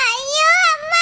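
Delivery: slow, drawn-out cry of pain. Acted. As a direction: wail it out in labour pain. Labour pain scream.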